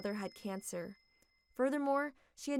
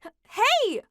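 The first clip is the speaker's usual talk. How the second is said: startled hey; 14 semitones higher, 13 dB louder than usual. Acted. Mother startled by outburst.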